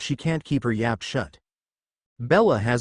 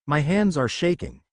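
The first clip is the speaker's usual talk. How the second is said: usual speaking voice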